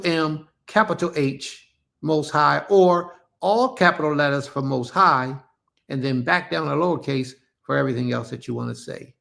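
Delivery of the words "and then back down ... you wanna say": normal talking voice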